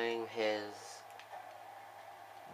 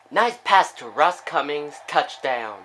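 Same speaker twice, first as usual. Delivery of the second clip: loud, punched commentator's shout; excited touchdown call; 4 semitones higher, 10 dB louder than usual. Real, not acted. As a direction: call the score out loudly with real excitement. Calling a touchdown pass.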